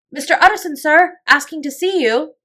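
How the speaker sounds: loud, high servant's announcement; calling out. A narrator's reading. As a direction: announce a visitor through a shut door. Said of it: "he called".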